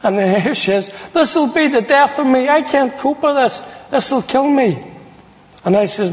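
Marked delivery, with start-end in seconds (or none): 1.1-4.8 high-pitched wail, lightly imitated; a mother's mock despairing outburst; retold upset mother. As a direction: plead in a high, overwrought imitation. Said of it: "That's the way she was shouting".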